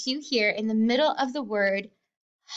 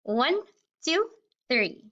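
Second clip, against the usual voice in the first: normal talking voice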